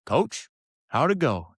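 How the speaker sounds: high, rising country-coach greeting; Coach Vincent. Retold or imitated. Coach asks about the test, imitated high country voice. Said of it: "good old country boy from Vernon".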